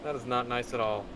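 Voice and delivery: ordinary delivery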